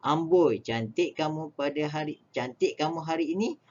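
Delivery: ordinary talk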